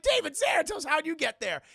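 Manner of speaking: shouted high in shock; surprised shout. Retold or imitated. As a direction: yell his name, stunned. Surprised meeting Xanatos at gate.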